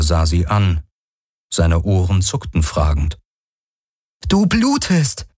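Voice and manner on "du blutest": high-pitched shocked exclamation; shocked discovery, pitch way up; alarmed. A narrator's reading. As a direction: blurt it in alarm, voice raised high. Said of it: "Sie brach erschrocken ab".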